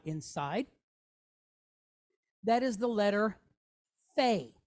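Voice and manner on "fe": Fe starting with F, "fay"; f sound. Shown as a demonstration. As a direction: say Fe with an airy F at the front. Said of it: "Like an F".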